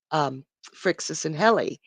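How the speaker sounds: ordinary delivery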